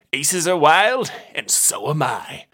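slow, loud swagger on the first words; hammed-up tough guy bravado; boasting detective. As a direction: savor the brag. Brunt boasts at poker.

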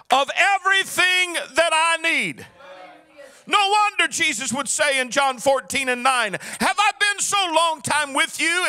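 fervent sung preaching cadence over the organ; full-force preaching. Acted. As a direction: preach it, half-singing each line.

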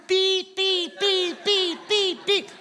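high, quick beep beep noises; imitated horn taps. Preacher imitating Honduran drivers tapping their horns. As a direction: tap out the horn with your voice.

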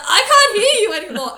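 high, very loud hammed-up alarm; mock panicked cry. Fainting patient calls to housemate as hearing goes, loud mock panic. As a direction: call out as senses fade, hammed up. Retold moment before fainting.

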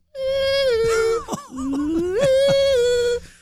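high squeaky Gizmo trill; Gizmo ringtone squeal. Real, not acted. Telling a friend about his ringtone, a high squeaky trill. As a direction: imitate the Gizmo ringtone singing. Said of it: "The hell was that?".

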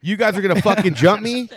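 mock-tough callout to the guys circling; defiant challenge. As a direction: dare them to jump you, voice up. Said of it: "I'm like".

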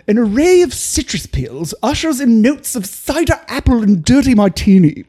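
put-on uptight British accent; lilting mock-British English. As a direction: read it like a hoity-toity British taster. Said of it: "do the finish with a, a British accent".

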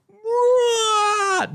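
shocked mom. Retold or imitated. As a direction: squeal it like an outraged mother. Mom reacts to broken yardstick.

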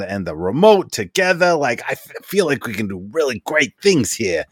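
excited inventor rattling off praise; Bartleby voice. Acted. GM voicing Bartleby thanking the party, eager and gushing. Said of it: "so I'm gonna do my best Bartleby voice".

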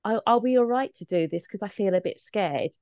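normal talking voice

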